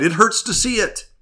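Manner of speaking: high pained cry; fearful cry. A narrator's reading. Cave people complain of the light hurting, high pained cry. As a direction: wince away from the light, high. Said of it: "they cried".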